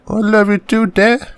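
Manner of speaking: put-on high boyish voice; young son voice. Son answers his dad.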